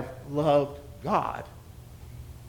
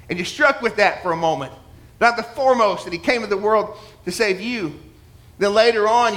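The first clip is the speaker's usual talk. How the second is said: ordinary talk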